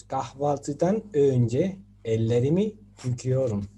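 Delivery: kahvaltıdan önce ellerimi yıkıyorum read slowly, each Turkish syllable laid out clearly; teacher gives a model Turkish sentence for students to copy. Shown as a demonstration. Slow, careful demonstration. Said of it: "por ejemplo".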